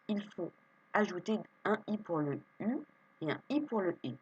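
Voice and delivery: ordinary talk, nothing marked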